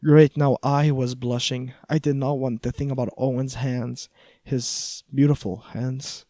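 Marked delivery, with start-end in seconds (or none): none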